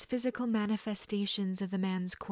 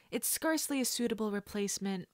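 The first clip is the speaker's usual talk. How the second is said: ordinary delivery, nothing marked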